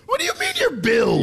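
shocked, shouting in disbelief; incredulous shout. Real, not acted. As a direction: yell it in outrage. Host hears caller roots elsewhere.

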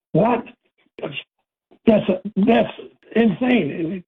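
high, incredulous "what?"; mock disbelief. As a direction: play the stunned caller who can't believe it. Host voices a stunned listener reaction.

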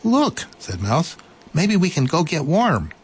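high, bright little mouse voice; eager little mouse. Acted.

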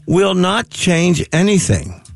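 slow, deliberate delivery; drawn-out emphatic warning. Shown as a demonstration. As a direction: spell it out slowly for the listeners. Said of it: "may I say it slower".

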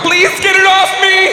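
shrieking high for help, pinned under a hot bike; high panicked yell. Pretend. Man trapped under his bike yelling to women in high panic.

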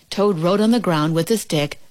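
plain reading voice

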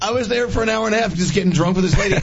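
laughing while talking. Real, not acted. Laughing while telling funny story.

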